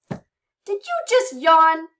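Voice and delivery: high, loud, drawn-out mock disbelief; mock surprise. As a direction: playfully call out the guest, high and slow. Teasing guest Molly for yawning.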